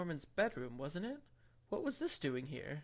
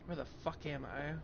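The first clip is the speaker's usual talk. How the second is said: normal talking voice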